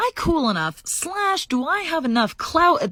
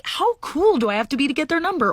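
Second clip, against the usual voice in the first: ordinary talk, nothing marked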